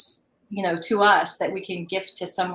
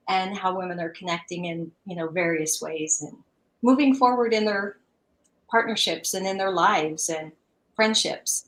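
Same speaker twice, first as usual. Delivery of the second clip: ordinary talk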